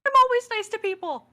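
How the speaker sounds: high, loud, quick retort; defensive protest. Acted. Defends self in acted quarrel.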